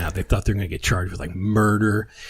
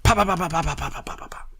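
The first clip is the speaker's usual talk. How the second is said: quick mimicked pops; pop-pop-pop like firecrackers going off; 6 semitones higher, 5 dB quieter, faster than usual. Mimicking the firecrackers thrown on the porch, quick pops.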